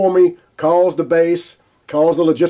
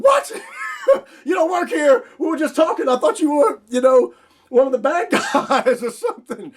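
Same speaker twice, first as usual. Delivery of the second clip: much higher, half-laughing yell; shocked disbelief; 9 semitones higher than usual. Retold or imitated. Finding out Wilson doesn't work at the airport, high laughing disbelief. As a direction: cry out in astonished laughter.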